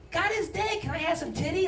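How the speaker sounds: a hint of a deadpan baby. Newborn looking up at the parent, high and deadpan. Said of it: "looked up at you like".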